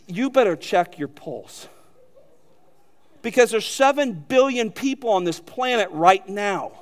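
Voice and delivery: ordinary talk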